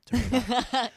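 sudden loud high shout. Real, not acted. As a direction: yell it up high. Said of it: "turn your volume up".

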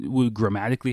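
ordinary talk

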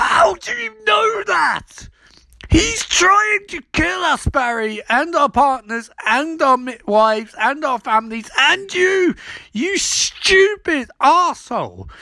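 very high, slow, sobbing yell; hysterical yelling. Retold or imitated. Ronnie yelling at Barry, high, slow and tearful. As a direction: scream it at him near tears. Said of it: "And Ronnie's like".

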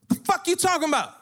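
high-pitched, loud outburst; exasperated yell. Retold or imitated. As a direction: yell it in disbelief. Retold exasperated reply mid-threat.

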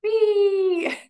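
high, gleeful whee; excited squeal. Real, not acted. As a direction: squeal with delight at the crowd.

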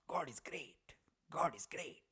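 soft, dropping into a whisper of awe; whispered praise for the food; reverent hush. Real, not acted. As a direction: savor it like a prayer. Praising the yogurt as divine.

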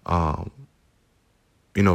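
normal talking voice

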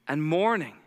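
normal talking voice

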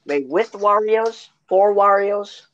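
slow, high sing-song shop slogan; mock ad slogan. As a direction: stretch each phrase, drop on the last. Acted meat shop slogan.

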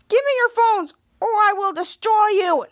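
mock evil demand; hammed-up villain threat. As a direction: threaten like a cartoon bad guy. Disguised hero plays villain.

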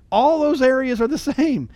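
laughing, high and loud. Real, not acted. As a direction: laugh through it.

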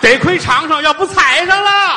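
high, loud and drawn out; shouted punchline. Pretend. Comedian yells the punchline at his partner, high and loud. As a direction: yell the payoff up high.

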